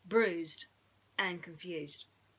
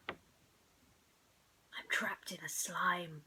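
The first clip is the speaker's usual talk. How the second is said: slow, breathy trapped whisper; creepy slow whisper from the slime; spooky whisper; 2 semitones lower, much slower than usual. Pretend. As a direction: whisper it slowly as if stuck. Pretending trapped in alien slime.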